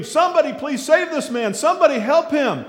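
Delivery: high, loud alarmed pleading; frantic scream. Retold or imitated. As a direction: yell to the crowd to save the man. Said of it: "she begins to scream".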